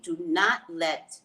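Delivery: ordinary talk, nothing marked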